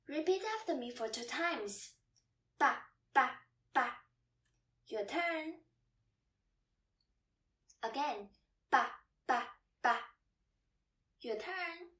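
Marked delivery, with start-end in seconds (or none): 2.6-3.9 "Ba, ba, ba", each short, punched and separated; short fifth tone. Shown as a demonstration. Teacher modelling the second word to repeat, short and fast. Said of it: "second Chinese word with the fifth tone". 8.7-10.0 "Ba, ba, ba", each short, punched and separated; quick, cut-short "ba" on each beat; short fifth tone. Shown as a demonstration. Teacher repeating the second word for learners, short and fast. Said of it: "second Chinese word with the fifth tone".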